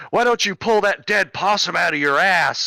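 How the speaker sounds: acted angry insult, high and loud; pissed-off outburst. Angry kid snapping at the officer searching someone, pissed off. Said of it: "getting pissed off".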